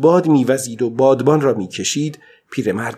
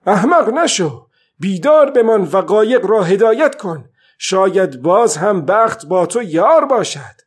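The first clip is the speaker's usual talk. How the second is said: put-on old man scolding himself aloud, loud and much higher; 7 semitones higher, 4 dB louder than usual. A narrator's reading. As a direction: shout at yourself to stay awake. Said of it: "پیرمرد با صدای بلند گفت".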